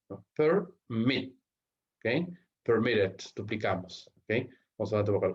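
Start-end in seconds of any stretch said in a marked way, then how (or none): 0.4-1.4 "Permit" in two beats with a gap, per... MIT; syllables split, final stress. Shown as a demonstration. Teacher repeating the stress example, "Permit" broken as per... MIT. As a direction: pause after "Per", then land on "mit". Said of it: "la mayor fuerza de voz".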